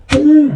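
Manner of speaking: loud, punchy crash word; mock boom. Describing the door smashing the wall, a loud boom. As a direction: slam it out like a door hitting the wall.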